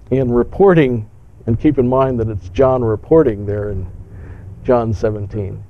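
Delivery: usual speaking voice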